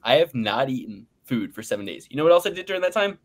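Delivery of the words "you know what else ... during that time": fast, higher hyped question; boastful build-up. Real, not acted. Bragging to co-hosts about his fast, fast and hyped. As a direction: rattle it off in a brag.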